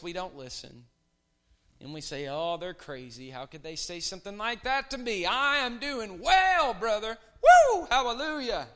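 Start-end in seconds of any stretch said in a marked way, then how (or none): 2.3-8.7 building to a loud high mock shout; mock triumphant Woo and Hallelujah; mock proud believer. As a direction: ham up the self-satisfied churchgoer. Mocking proud churchgoer.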